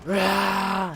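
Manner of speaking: loud, high mock battle roar; imitated enraged roar. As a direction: roar like a kid charging in rage. Imitates enraged kid lunging.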